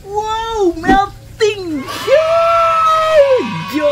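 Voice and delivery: high, excited whoop over melted cheese; excited shout. Real, not acted. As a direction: cheer it out, thrilled. Cake cut, cheese melts. (teriakan girang)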